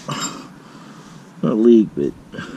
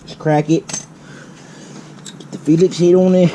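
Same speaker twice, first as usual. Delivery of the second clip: Australian accent; 4 semitones higher, 6 dB louder, slower than usual. Real, not acted. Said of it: "why I'm talking in an Australian accent".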